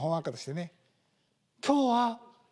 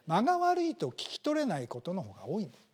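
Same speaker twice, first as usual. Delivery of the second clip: ordinary delivery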